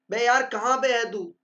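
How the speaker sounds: loud, annoyed call to a friend; angry demo. Shown as a demonstration. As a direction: snap it like you're fed up. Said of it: "गुस्से में दे रहे हो". (गुस्से में डांटते हुए)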